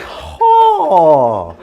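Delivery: high, long "Ho."; drawn-out call. Shown as a demonstration. Teacher shows the class how they say it, drawn-out call. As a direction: draw out "Ho." high and loud. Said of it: "We say".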